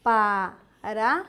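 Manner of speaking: "Paraná" slowly, pa... ra... ná; syllable by syllable. Shown as a demonstration. Teacher separating the word for the class, "Paraná" slowly split. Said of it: "Separando a palavra".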